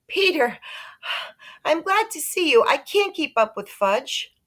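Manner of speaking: high, breathless relieved voice; lightly voiced harried mom; flustered mother. Mother relieved to find Peter, high flustered voice.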